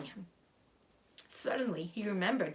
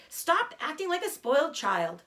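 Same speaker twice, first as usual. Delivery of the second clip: angry shout; 9 semitones higher, 6 dB louder than usual. Acted. Wilbur shouting at rat.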